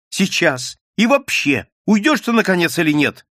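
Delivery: high angry outburst. Acted. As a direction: lose patience, push him out. Nadya drives the man out. (гневно, высоким голосом)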